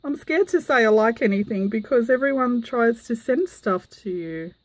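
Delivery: ordinary talk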